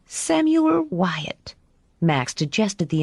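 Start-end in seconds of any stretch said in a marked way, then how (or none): 0.1-1.6 high, slow, weighty naming; Madame voice. A narrator's reading. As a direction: draw the name out slowly. Madame names the boy.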